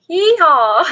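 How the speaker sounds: put-on loud high cowboy yeehaw, Southern accent. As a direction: whoop it like a Texan rodeo call in a Southern accent. Joking about Southern family accents.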